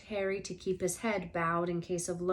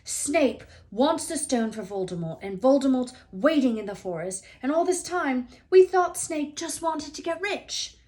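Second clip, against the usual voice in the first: higher, louder, rushing; shaky, agitated outburst, much higher and louder; 6 semitones higher, 8 dB louder than usual. A narrator's reading. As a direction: blurt it out, still shaking. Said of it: "He was still shaking".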